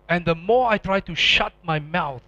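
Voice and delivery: normal talking voice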